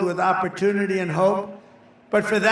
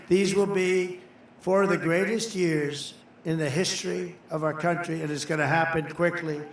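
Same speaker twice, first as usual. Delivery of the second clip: ordinary talk, nothing marked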